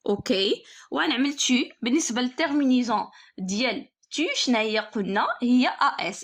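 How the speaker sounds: normal talking voice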